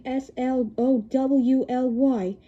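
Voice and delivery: slow letter-by-letter spelling; slow spelled letters; spelled slowly. Shown as a demonstration. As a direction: spell it for the kids. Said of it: "spells slowly".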